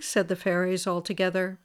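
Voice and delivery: ordinary delivery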